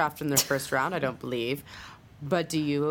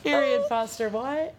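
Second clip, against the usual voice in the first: surprised; 5 semitones higher, slower than usual. Real, not acted. Gasps at sudden news.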